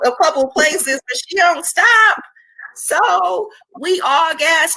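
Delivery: fighting back tears while praising her; tearful, choking up. Real, not acted. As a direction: voice rising high with real tears. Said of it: "I wasn't gonna cry".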